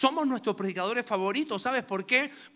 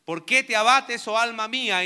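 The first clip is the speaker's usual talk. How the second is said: loud reading; 3 semitones higher, 7 dB louder than usual. Shown as a demonstration. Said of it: "el estribillo que aparece tres veces".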